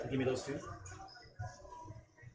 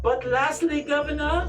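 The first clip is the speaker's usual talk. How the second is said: Lady Amelia; 9 semitones higher than usual. Acted. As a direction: speak as a haughty, grieving matriarch.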